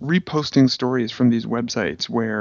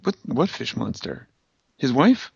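usual speaking voice

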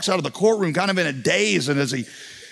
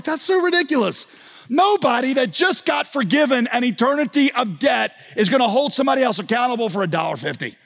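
much higher, scoffing incredulity, light imitation; incredulous crowd; 9 semitones higher than usual. Listeners reacting to Jesus' story, mock disbelief. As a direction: scoff it like a crowd that can't believe it. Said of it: "everybody listening is like".

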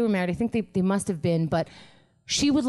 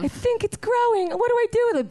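much higher, silly put-on squeal; mock squeaky voice; 14 semitones higher than usual. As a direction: squeal it like a panicked cartoon. Jokes about drawing.

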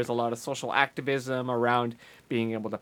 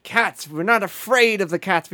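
mock rallying cry; 6 semitones higher, 6 dB louder than usual. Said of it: "she gets them to try to say".

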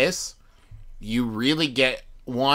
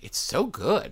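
higher-pitched, mildly amazed praise; pleasantly surprised; 7 semitones higher, slower than usual. Retold or imitated. Wife reacting to the album she just heard, pleasantly surprised. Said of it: "like, in surprise".

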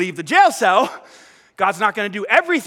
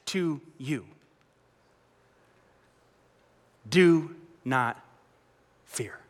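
God's tender promise; 3 semitones lower, slower than usual. Acted. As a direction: lay each promise down slowly and tenderly. Preacher voices God's promise.

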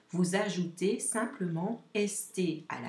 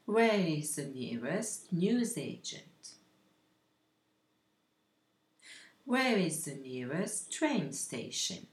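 model sentences to repeat. Shown as a demonstration. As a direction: leave space for learners to echo. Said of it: "vous allez répéter après moi".

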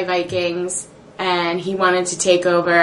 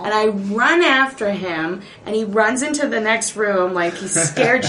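normal talking voice

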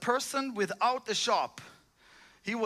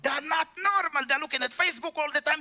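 preaching; 5 semitones higher than usual. Real, not acted. Preacher building up to shouting.

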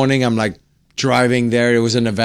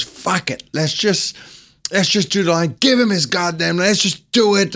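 higher-pitched mock exasperation; imitated pissed-off star; 6 semitones higher than usual. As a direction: retell an actor's angry giving-in, not shouted. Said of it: "He's, like, really pissed".

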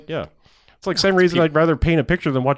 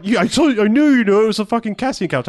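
smug friend; 8 semitones higher than usual. Retold or imitated. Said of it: "he was like".

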